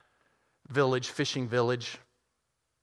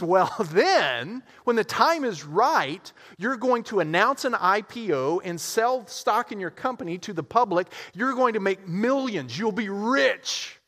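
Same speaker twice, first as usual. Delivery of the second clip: higher, chuckling sales pitch; eager businessman; 7 semitones higher than usual. Acted. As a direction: sell him the dream, eager. Businessman, selling the dream.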